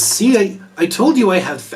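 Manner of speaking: lightly voiced vindicated elf; pleased boasting. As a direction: show off to a doubter, a little eagerly. Elf boasts of his fans.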